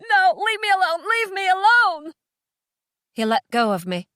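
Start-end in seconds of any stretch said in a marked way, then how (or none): 0.1-2.1 high, loud desperate protest; hysterical, high-pitched shouting; frantic yelling. A narrator's reading. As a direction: yell at him to back off. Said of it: "I yelled at him".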